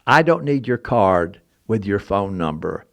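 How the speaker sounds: low, slow and soft, every word weighed; mysterious stranger. Retold or imitated. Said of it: "I want to do his voice exactly how he said it".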